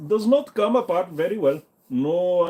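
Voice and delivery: usual speaking voice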